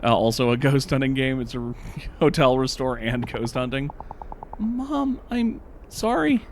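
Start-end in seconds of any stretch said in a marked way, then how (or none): 4.6-6.5 put-on very high-pitched, apologetic little-kid voice; worst child voice. Child apologizing to mom in a game, squeaky put-on voice. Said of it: "That was the worst voice I've ever done".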